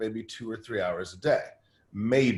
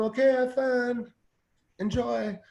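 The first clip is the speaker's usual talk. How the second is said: much higher, slow, sing-song easygoing; breezy mock reply; 12 semitones higher, slower than usual. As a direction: play the relaxed boss waving it off. Boss's breezy reply voiced.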